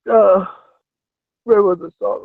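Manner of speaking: ordinary talk, nothing marked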